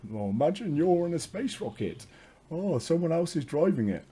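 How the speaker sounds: lilting, high imitation of a guide; mimicking guided meditation. Man retelling what the guided meditation said, mimicking it. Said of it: "In the guided meditation, it was saying".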